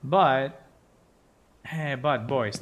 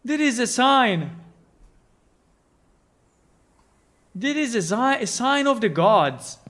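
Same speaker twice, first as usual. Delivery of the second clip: excited proclamation; 7 semitones higher, 4 dB louder than usual. Acted. Continues godly proclamation.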